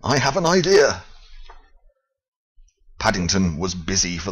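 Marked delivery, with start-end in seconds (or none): 0.0-1.0 eager idea. Acted. Paddington eager.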